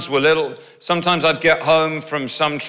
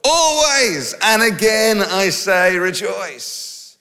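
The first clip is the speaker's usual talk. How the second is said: slow, raised scripture reading; scripture proclaimed; 5 semitones higher, slower than usual. Shown as a demonstration. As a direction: proclaim the verse like preaching. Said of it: "Philippians four verse four".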